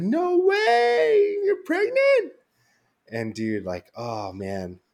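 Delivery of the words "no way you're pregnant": very high, loud, crying with joy; flipping out. Retold or imitated. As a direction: squeal it through happy tears. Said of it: "she starts flipping out".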